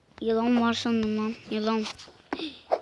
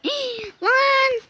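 high gasp of surprise; gasp, then a high startled word; 11 semitones higher than usual. Real, not acted. Startled by the game.

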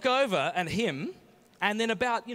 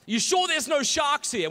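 high, loud mock-worried question; hammed-up worried friend, pitched right up; imitated anxious friend; 10 semitones higher, 8 dB louder than usual. Comedian imitating his friend asking about sharks, high and loud. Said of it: "I don't have the muscle in the tongue".

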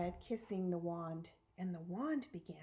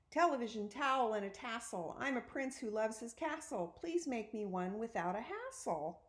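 ordinary delivery

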